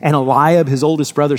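ordinary talk, nothing marked

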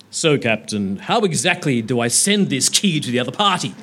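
a puzzled Klingon question; bad Klingon accent. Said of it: "very bad Klingon accent".

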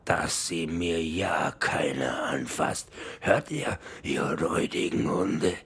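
rasping pirate captain, slow orders. Acted. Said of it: "brüllte".

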